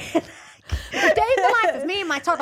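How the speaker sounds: high, slow, laughing parody; mock the vlog title laughing; laughing mock vlog intro.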